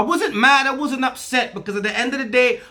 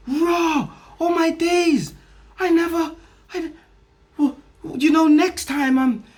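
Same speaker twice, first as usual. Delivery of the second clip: put-on higher, gasping voice of the amazed girlfriend; mock amazed girlfriend; 8 semitones higher than usual. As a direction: play her stunned realisation, pitched up. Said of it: "It was like".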